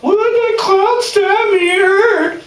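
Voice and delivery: put-on high, slow whining little girl; crybaby voice. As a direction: whine like a crying little girl. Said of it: "cry like a little girl".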